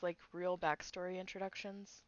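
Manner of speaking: ordinary talk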